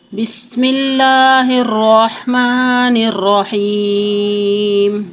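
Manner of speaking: slow melodic tajweed chant; Quran recitation. Shown as a demonstration. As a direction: recite it like in the mosque.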